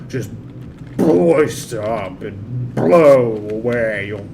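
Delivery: slow, loud hammed-up British oration; very British man. British man urging soldier to beat competition, slow mock British accent. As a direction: draw out the words in pompous British. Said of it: "As a very British man".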